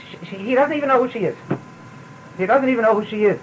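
normal talking voice

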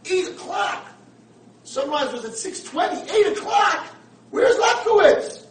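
high loud cries, last line slowed; rising panic, almost shouting; panicked disbelief. Retold or imitated. Blind man realizes he overslept.

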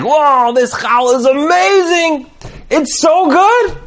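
high-pitched rapturous raving, stretched out; gushing delight. Retold or imitated. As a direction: gush like an over-delighted foodie. Rebbe raves over the challah.

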